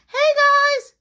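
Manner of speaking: put-on high, loud childish hello; goofy kid greeting. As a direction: chirp it like a dorky little brother. Marky quoted, kid voice.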